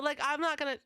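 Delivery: normal talking voice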